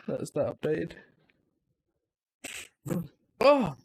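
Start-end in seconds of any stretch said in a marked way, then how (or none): 3.4-3.8 sneezing. Real, not acted. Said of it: "that was an aggressive sneeze".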